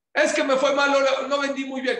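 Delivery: high, loud whiny excuse; mock complainer. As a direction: whine like someone sulking over a bad sale. Mocks people complaining about losses. (queja burlona, aguda y fuerte)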